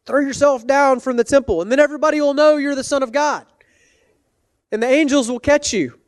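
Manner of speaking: high, fast wheedling tempter. Retold or imitated. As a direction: tempt him with a sly voice. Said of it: "the same voice that tempted him".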